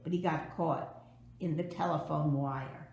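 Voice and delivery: usual speaking voice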